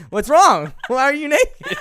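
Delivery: high, loud mock outrage breaking into laughter; hammed-up shocked questioning; mock alarmed shout. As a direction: yell it in comic disbelief. Acts out a startled confrontation.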